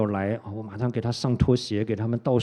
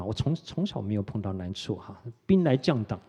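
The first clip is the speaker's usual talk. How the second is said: ordinary talk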